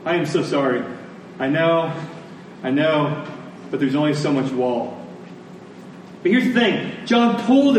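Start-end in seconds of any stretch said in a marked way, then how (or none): 0.0-5.0 lightly voiced sheepish apology, softer; mock apology. Preacher voicing John as an apologetic curator, a touch softer. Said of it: "That is a curator's apology".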